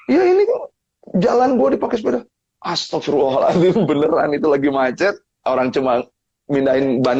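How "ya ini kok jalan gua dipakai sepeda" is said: irritated complaint. Retold or imitated. Cyclist grumbling that his road was taken, high and irritated. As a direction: whine it out, high and aggrieved.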